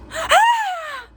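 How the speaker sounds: sudden high gasp of fright; sharp gasp. Retold or imitated. Passengers feel the plane drop, sharp gasp. Plane drops, screams start.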